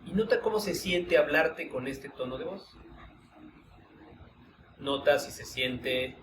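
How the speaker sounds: calm, unhurried guiding voice with long pauses; guided meditation. Acted. Teacher guiding students through a voice-tone exercise, meditative. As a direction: lead the group inward through the exercise, gently.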